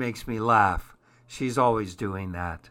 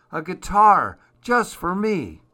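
put-on excited child; 6 semitones higher, 5 dB louder than usual. Child receives a guitar.

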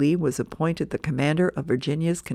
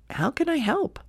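Snoopy thinking; 10 semitones higher than usual. Acted. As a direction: muse it to yourself. Snoopy's thought.

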